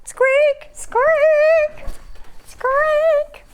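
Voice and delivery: high-pitched drawn-out squeaks; imitated squeak. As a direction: squeak like a little toy, very high.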